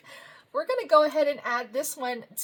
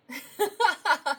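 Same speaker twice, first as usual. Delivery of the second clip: giggle; 6 semitones higher than usual. Real, not acted. Laughing at the honey card.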